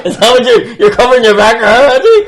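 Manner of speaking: mock accusing, laughing; loud teasing laugh. Real, not acted. Teasing friend, laughing loudly.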